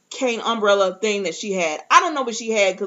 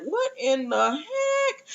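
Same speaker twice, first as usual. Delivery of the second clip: slow, high-pitched mock bewilderment; stunned disbelief; 7 semitones higher, 4 dB quieter, much slower than usual. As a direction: stretch it out high, as if appalled. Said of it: "everybody in the room is just looking at Aunt Lydia like".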